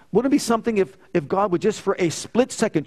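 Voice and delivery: ordinary talk, nothing marked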